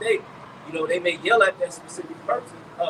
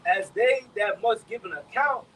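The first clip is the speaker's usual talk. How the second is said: slow, raised, higher-pitched reading of Hebrews; scripture reading; 4 semitones higher, 5 dB louder, slower than usual. Acted. Reading Hebrews to the brothers, slow and proclaiming. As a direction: proclaim each phrase slowly, like reading from the pulpit. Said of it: "It says".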